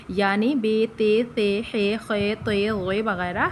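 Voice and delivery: Urdu-style بے، تے، خے with a final e; wrong Urdu-style letter names. Shown as a demonstration. Teacher demonstrates the wrong Urdu letter names. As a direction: say بے، تے، خے the Urdu way, ending in e. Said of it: "ہرگز نہ پڑھیں".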